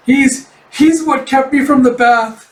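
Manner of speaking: high, loud, slow stammer; pretend-frightened wail; mock screaming panic. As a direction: stammer out a terrified confession, hammed up. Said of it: "you hear him just screaming".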